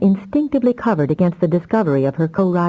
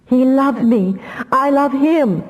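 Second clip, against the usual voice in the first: desperate insistence; 8 semitones higher, slower than usual. Acted. Damia clings to her love.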